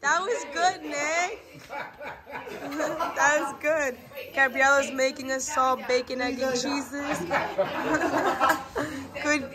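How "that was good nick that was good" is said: high, slow praise with laughter; delighted praise. Real, not acted. Aunt praising Nick for saying the words, high and slow.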